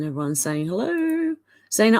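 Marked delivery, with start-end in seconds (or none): 0.7-1.4 high, drawn-out hello; sing-song greeting. Retold or imitated. Streamer relaying everyone's hello to the chat, high sing-song.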